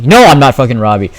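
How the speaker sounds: loud, quick correction; indignant retort. Retold or imitated. Student correcting teacher's wrong name, loudly indignant. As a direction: snap it back, fed up.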